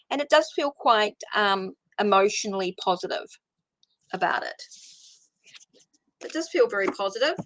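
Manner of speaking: normal talking voice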